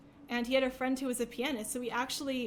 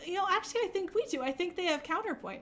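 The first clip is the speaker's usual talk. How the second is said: mock-polite correction, pitched higher; overly polite; 6 semitones higher than usual. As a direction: sweetly correct the teacher, in a higher voice. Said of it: "very politely".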